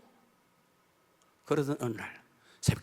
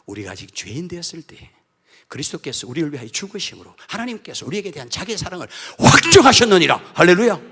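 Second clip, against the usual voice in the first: louder scripture proclamation ending in hallelujah; proclaiming scripture; 12 dB louder than usual. Acted. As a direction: proclaim the verse to the congregation.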